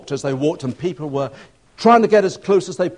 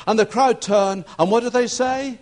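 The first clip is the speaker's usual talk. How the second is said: normal talking voice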